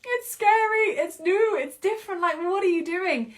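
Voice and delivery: high, slow, loud panicked brain; fearful inner voice. Retold or imitated. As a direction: voice the scared mind, pleading. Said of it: "your conscious brain is saying".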